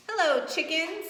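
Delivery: chirpy duck saying hello; cheery duck. Acted. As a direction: call out a bright hello as Duck. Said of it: "said Duck".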